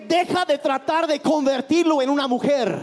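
match the preacher's fire; predicación enérgica en voz alta; impassioned preaching. Acted. Interpreter matches preaching force.

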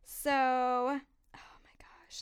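hushed spooky whisper; whispered ghost-story hush. Real, not acted. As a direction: drop to a whisper for the creepy bit. Whispering the scary part of story.

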